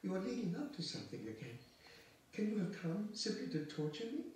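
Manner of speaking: high-pitched anguished plea; distressed, near crying. Acted. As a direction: plead as if about to break into tears. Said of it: "could not control herself and began crying".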